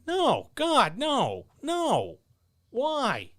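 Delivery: squeaky high, appalled no's; mock horror. Answering the hourly-rate question, high mock horror. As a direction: recoil in exaggerated disbelief, way up high.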